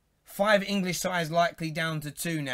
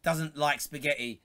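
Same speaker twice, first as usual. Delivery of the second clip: ordinary talk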